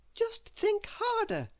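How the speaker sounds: put-on high, slow girl's voice pushing herself to think; urging herself on. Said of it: "she told herself".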